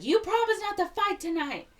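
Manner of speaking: high, loud mock scolding; scolding girlfriend. [name] reminds Willard of his promise, high scolding. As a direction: pitch it way up and protest.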